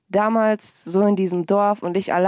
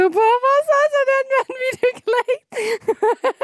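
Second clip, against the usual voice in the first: amazed squeal. Retold or imitated. Learning [name] liked her video, squealing amazement. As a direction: squeal it like you can't believe it. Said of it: "Ich so".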